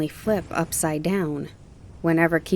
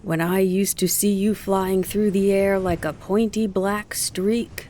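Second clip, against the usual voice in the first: 1 townsperson; 3 semitones higher, slower than usual. A narrator's reading. Townspeople praise her new flying.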